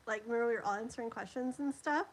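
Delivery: normal talking voice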